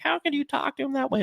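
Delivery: very high mock-weepy wail; high-pitched pretend crying; imitated crying grandmother. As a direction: play a tearful grandma, pleading. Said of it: "like, crying. She's like".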